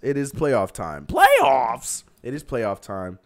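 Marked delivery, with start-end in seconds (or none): 1.1-2.0 very high, loud hype call; hyped shout. Acted. Streamer hypes up his chat that it's playoff time, shouting. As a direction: announce it like an arena host.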